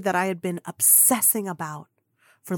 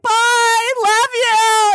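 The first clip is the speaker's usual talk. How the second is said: much higher, much louder squeal; overexcited goodbye; 13 semitones higher, 9 dB louder, much slower than usual. Retold or imitated. Fan shouting goodbye to Kim, squealing. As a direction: squeal it as you're led away. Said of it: "I was like".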